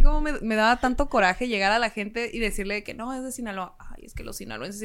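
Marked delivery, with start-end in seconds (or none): 3.8-4.8 mock snooty sigh. Mimicking condescending outsider.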